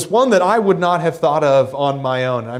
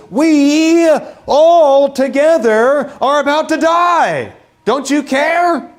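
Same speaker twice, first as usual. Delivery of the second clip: high, louder, slow and drawn out; desperate plea; 8 semitones higher, 4 dB louder, slower than usual. Acted. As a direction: stretch it out like sinking men crying for help. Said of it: "I might ask the same question".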